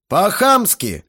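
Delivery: loud, high outburst; angry blurt. A narrator's reading. Guest answers the mocking teenager, loud angry blurt. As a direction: fire it straight back, indignant. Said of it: "выпалил Грохотов".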